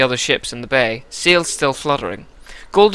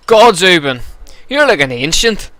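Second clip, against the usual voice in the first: loud, gruff Ulster greeting; gruff Ulster accent; 5 semitones higher, 6 dB louder than usual. Acted. Giant Vognir greeting Ubin at the docks, gruff Ulster accent. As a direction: hail him in a rough Ulster accent. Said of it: "give him a bit of a gruff Ulster accent".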